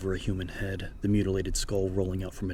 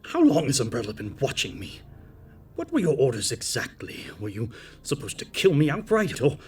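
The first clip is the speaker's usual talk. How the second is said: loud, higher raging questions; furious interrogation; 7 semitones higher, 8 dB louder than usual. Acted. Irons furious at the assassin, loud raging questions. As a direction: hammer her with the accusations.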